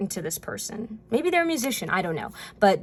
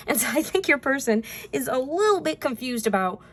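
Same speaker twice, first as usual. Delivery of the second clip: ordinary delivery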